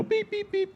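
high imitated bleep tone; censor beeps. Shown as a demonstration. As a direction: mimic a censor bleeping out swearing. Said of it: "you can fill in the beeps".

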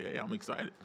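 very soft, whispered enthusiasm; muted quiet reply. Shown as a demonstration. Pastor modeling a quiet text reply to him, hushed whisper. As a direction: whisper it back, barely audible. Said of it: "a lot of mute out and just quiet".

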